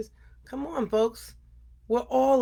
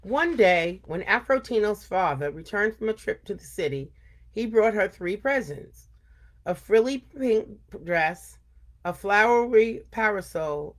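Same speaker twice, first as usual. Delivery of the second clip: put-on lively read-aloud for kids; storytelling voice for a young audience; story reading. A narrator's reading. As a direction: tell it like story time for children. Reads story to children.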